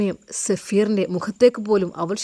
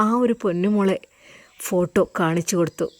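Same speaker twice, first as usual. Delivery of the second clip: plain reading voice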